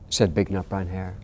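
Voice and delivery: put-on gentle read-aloud for children; storybook reading. A narrator's reading. Author reading his bedtime book aloud to interviewer, gentle storytelling.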